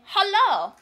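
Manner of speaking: very loud, high corrective greeting; emphatic retort. Retold or imitated. [name] proves his English to Americans, emphatic retort. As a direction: belt the English word back at them. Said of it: "I say".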